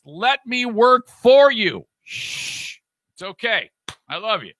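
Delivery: slow, then hushed comforting; calm him down like a child; mock soothing.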